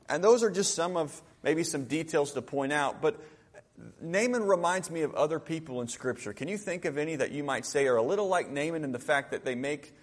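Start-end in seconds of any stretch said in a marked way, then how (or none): none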